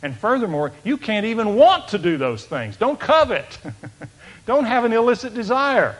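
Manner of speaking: fervent preaching. Acted. Preaching at full force.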